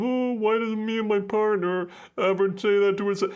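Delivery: very high, whiny longing; envious whine. Pretend. Viewer envying couples online, whining high. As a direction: whine with envy at the screen.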